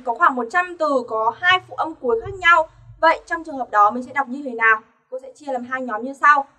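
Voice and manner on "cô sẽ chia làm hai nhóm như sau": ordinary delivery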